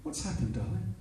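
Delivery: high, soft coaxing; talking to a child. Retold or imitated. Girlfriend coaxing her upset man, as to a child. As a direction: coax gently in a high cooing voice. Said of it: "She talks to me like I'm a child".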